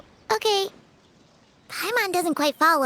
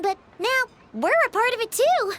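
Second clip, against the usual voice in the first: usual speaking voice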